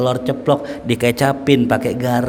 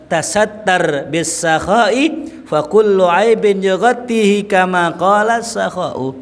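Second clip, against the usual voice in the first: recitation; 6 semitones higher, slower than usual. Acted. Reciting Arabic verse slowly.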